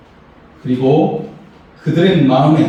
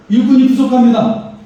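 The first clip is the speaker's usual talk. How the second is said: a touch of worried people pleading, higher; complaining townspeople; 8 semitones higher than usual. Retold or imitated. Preacher voicing Judah's people complaining to him, higher and anxious. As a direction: voice the anxious builders crying out, raised pitch. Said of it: "그렇게 말을 하고 있는 것입니다".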